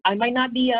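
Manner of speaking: normal talking voice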